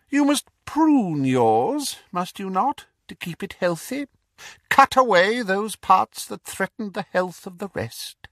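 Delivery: high, cold, mocking villain voice; cold dark lord. Dark lord mocking the Death Eaters, cold and sneering. Voldemort instructing Bellatrix.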